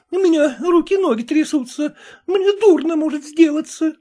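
shrill pleading, near tears; a wife begging her husband to take her away, crying and terrified; terrified squeal. A narrator's reading. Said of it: "пронзительно взвизгнула".

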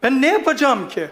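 ordinary talk, nothing marked

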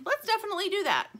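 high, loud and fast; eager agreement. A narrator's reading. Lumpy agrees to Stingray's bowling plan, eager. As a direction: jump at the idea.

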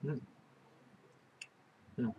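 humming with closed mouth; mouth full chewing. Real, not acted. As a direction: murmur between bites. Seen 0: Lips closed, pizza slice in hand after biting, humming while chewing.

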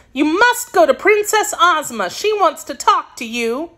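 put-on high girlish voice, firm; a child's high voice laying down orders; insistent little girl. Girl sending her kitten to the princess, insistent.

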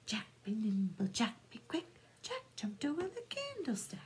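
plain reading voice

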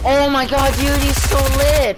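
high, loud burst of excitement; excited. Real, not acted. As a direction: gush about the enemy player, thrilled. Excited about an enemy player.

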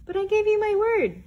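high, loud little mouse voice; squeaky mouse. Acted. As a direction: protest in a tiny high voice.

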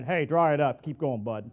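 a hint of a tough father telling the child to dry up; dismissive tough talk. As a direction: tell them to toughen up, gruffly. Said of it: "that's a whole lot different than".

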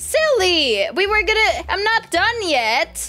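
high, drawn-out whine; whiny protest. Real, not acted. Telling Silly the house isn't done, high whiny protest. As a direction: complain she isn't finished yet. Friend sees build too early.

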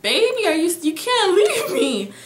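high, loud reenacted plea; screaming and crying. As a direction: wail it out, begging someone to stay. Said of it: "I'm screaming, and I'm crying".

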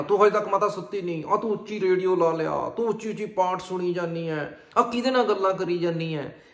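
acted fed-up son; irritated scolding. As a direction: fire accusations at your mother. Irritated son scolds mother again.

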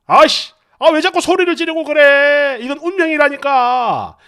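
loud, whiny mock complaint; husband needling his wife; teasing grin. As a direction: protest loudly to wind her up. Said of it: "끝까지 실실 웃으며 사람 약을 올리는데".

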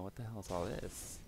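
ordinary delivery

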